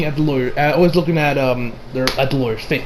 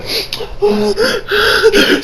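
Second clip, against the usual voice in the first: mock sobbing sigh. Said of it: "fake crying".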